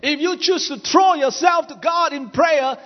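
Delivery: rising, forceful sermon delivery; preaching. Acted. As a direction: preach it out to the crowd. Preaching climax, shouting.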